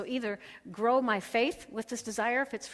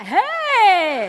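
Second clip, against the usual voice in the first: loud, high happy hello; husband's loud delighted hello to his feet; cheerful greeting; 10 semitones higher, 13 dB louder than usual. Retold or imitated. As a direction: call out to long-lost friends.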